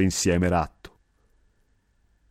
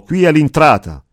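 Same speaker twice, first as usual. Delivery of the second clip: loud, higher call announcing the entrance; shout; 6 semitones higher, 6 dB louder than usual. A narrator's reading. The boatman announcing the landing, loud and high. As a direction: shout it across the marsh. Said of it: "gridò".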